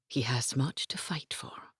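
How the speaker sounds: soft breathy whisper, no voice at all. A narrator's reading. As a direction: whisper it gently to the wounded man's wife. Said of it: "Lady Madeline whispered".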